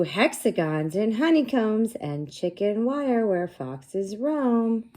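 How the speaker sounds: high, loud, slow storybook read-aloud; sing-song read-aloud for young kids; reading to children. Acted. Teacher reading the book aloud to her class, slow and bright.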